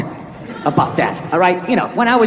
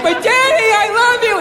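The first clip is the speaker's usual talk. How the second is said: high, slow, hammed-up crying child; mock crying; 9 semitones higher, slower than usual. A kid begging his father, mock crying high and slow. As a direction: blubber it out like a little kid, mock. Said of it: "standing there crying like".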